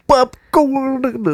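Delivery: high, slow, nasal-sounding bit; stuffy-nose impression. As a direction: pinch the nose and drawl it. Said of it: "that's kind of a stopped up nose one".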